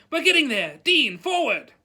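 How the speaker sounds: high, loud rallying shout; urgent teacher over the commotion. A narrator's reading. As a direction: shout encouragement, then order the pupil on. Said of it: "shouted Lupin".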